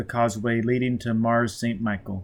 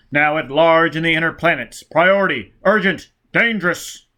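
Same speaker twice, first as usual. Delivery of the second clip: higher, louder bulletin read; official broadcast voice; 5 semitones higher, 6 dB louder than usual. Acted. Bulletin naming Foyle public enemy, high and loud. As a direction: announce the manhunt with urgency.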